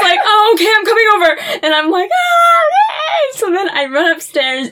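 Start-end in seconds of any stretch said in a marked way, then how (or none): 0.2-1.6 high, fast, louder crying rush; tearful retelling of Dad's reassurance; retold Dad. Dad quoted amid her crying. 2.1-3.4 very high, louder crying cheer; retold tearful relief. Retold relief Dad coming.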